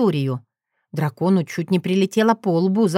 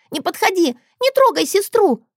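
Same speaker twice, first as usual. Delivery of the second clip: put-on high-pitched little girl's shout; child yelling; 12 semitones higher than usual. A narrator's reading. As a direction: yell it like a protective little sister. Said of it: "орала она". (детский крик, высоко)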